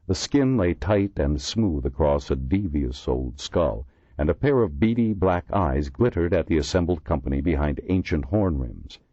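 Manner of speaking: usual speaking voice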